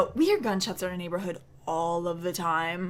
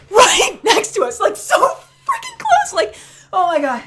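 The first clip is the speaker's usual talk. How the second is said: excited disbelief; 12 semitones higher, 8 dB louder than usual. Real, not acted. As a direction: relive the shock, laughing. Reliving the shots, excited.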